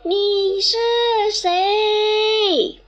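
high, loud questioning call. Acted. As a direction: shout the question to the mountain. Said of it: "又喊了一声".

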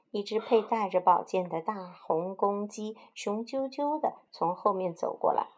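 usual speaking voice

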